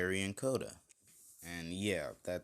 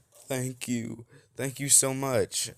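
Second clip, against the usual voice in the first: choked-up thanks climbing higher and louder; tearful thanks; 6 semitones higher, 6 dB louder, slower than usual. Acted. Rescued kids clinging to Deku, tearful thanks. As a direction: thank him through tears, voice rising. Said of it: "they're just kinda crying".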